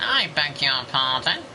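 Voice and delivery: high, slow, offended teacher voice; indignant trumpet-voiced teacher; teacher taking offence, trumpet voice. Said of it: "And she went".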